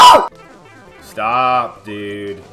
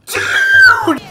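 high cry of disbelief. Real, not acted. Exasperated at game.

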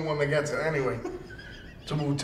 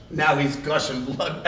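usual speaking voice

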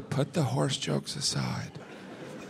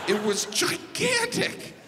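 awed exclamation; slower than usual. Real, not acted. Stressing moose size.

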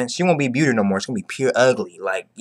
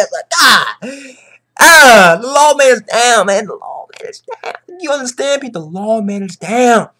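high, loud dramatic cry; dramatic wail; 9 semitones higher, 5 dB louder than usual. Acted. Fan telling viewers Law is down, high dramatic wail. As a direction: mourn it like breaking news. Dramatic announcing of Law's fall.

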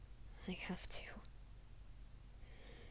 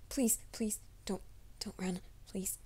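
urgent hushed begging; begging the startled creature in a whisper; whispered plea. Acted. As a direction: whisper the plea so it won't bolt. Pleading with a frightened creature.

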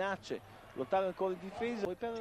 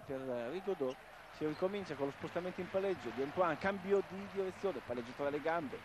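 normal talking voice

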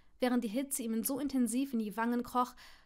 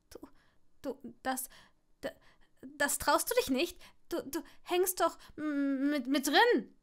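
rising, louder stuttering panic; panicked stammer; 4 semitones higher, 3 dB louder than usual. Acted. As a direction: stumble over every word.

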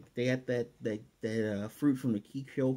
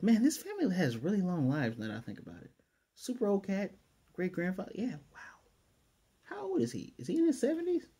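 high, quick put-on granny voice; grandma's musing; 8 semitones higher than usual. Grandma wondering aloud about family ages, high granny voice. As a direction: play a chatty grandmother marvelling, pitched way up. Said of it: "Grammy Higurashi is saying that".

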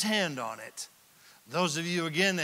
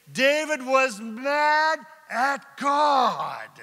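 "mad" slow and shouted, the whole line much higher; stress on mad; 6 semitones higher, 6 dB louder, much slower than usual. Acted. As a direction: drag out "mad" in a loud, high, slow cry. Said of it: "do you see it in verse eight?".

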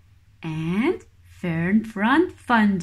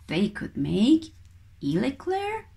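usual speaking voice

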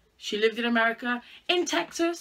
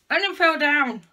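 dismayed; 5 semitones higher, 8 dB louder than usual. Real, not acted. Hair clip falls.

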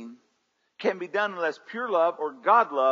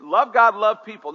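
loud emphatic declaration; said like you mean it; 6 semitones higher, 14 dB louder than usual. Real, not acted. Pastor repeating the vision statement loudly. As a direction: proclaim it boldly. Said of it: "say it like you mean it".

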